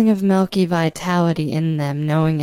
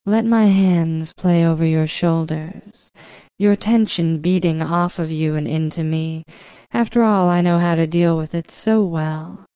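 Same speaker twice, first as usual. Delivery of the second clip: ordinary talk, nothing marked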